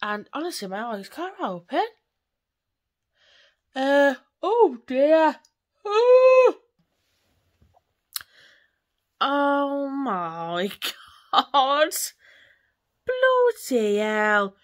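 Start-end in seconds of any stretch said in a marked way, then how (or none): 3.8-14.6 long, drawn-out, loud helpless laughter; real giggling fit; laughing fit. Real, not acted. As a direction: crack up at yourself until you can barely talk.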